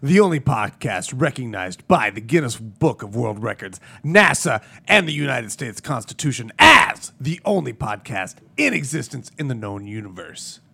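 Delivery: hammed-up podcast intro proclamation; grand show-opening announcer voice; mock-grand announcer. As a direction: announce it like an absurdly official title, punching key words. Opens the show in grand announcer style.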